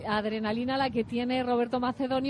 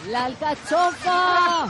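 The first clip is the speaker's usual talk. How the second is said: grito; 4 semitones higher, 6 dB louder, slower than usual. Real, not acted. Waves soak the microphone.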